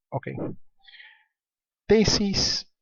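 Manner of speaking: usual speaking voice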